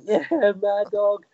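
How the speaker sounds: high, drawn-out mock cheer; cheering crowd imitation. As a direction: imitate fans egging him on, stretched out. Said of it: "the U K people and people who can speak English".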